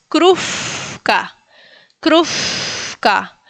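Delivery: "krufka" with a long blown F. Shown as a demonstration. Teacher shows third example, "krufka" with W as F. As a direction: stretch the F in "krufka" before the ka. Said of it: "se pronuncia como F".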